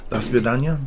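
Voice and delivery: "Das Vidanya" with a hammed-up Russian lilt, "Vidanya" rising at the end; mock Russian farewell. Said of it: "I hope that that's a respectful phrase".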